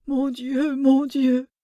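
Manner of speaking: put-on an old man overwhelmed with joyful shock; distraught exclamation. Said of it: "courir égaré dans la chambre en faisant". (exclamation égarée, plus haute et forte)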